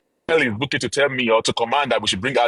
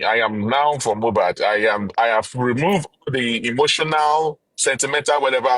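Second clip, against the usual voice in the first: ordinary talk, nothing marked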